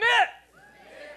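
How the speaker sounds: high, loud excited shout. Shown as a demonstration. Pastor rallying the congregation, excited shout. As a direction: fire it back with excitement. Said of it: "Say it excited".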